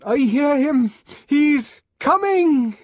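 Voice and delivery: high, loud, drawn-out cry; joining shout. A narrator's reading. Man feels he must join in, shouting loud and high. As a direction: shout it out with the rest. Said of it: "also jumped up and shouted".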